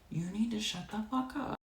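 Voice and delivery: mock husband, quiet and blunt; husband's blunt put-down. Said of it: "looked at her and goes".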